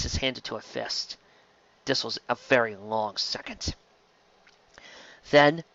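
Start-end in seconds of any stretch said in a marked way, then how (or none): none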